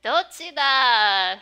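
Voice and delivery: slow, loud "Dochi da"; drawn-out loud kid challenge; loud demand. Acted. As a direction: call it out demanding an answer. Reads manga demand.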